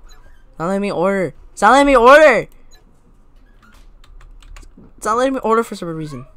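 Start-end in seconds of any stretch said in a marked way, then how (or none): 1.5-2.5 much louder, insistent complaint; frustrated shout. Real, not acted. Player blocked from ordering, frustrated shout. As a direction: raise your voice so they finally hear.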